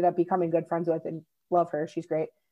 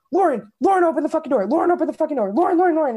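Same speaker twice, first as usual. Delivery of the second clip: higher, louder frantic pounding-door yell; panicked yelling; 7 semitones higher, 5 dB louder, faster than usual. Retold or imitated. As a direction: shout her name in a panic to wake her. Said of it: "he's, like, panicking".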